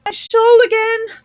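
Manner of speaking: pained wail of the queen; groaned out. Acted. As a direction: moan it out in distress. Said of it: "the poor Queen groaned out".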